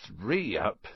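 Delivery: low-key, slow mutter to oneself; muttered counting. A narrator's reading. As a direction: count under your breath, deliberately. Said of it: "he muttered".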